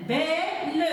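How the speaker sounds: belle split into two beats, "bè - le"; segmented syllables. Shown as a demonstration. Teacher showing two syllables in belle, said in separate beats. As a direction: hold "bè", then add "le" for belle. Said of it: "deux syllabes".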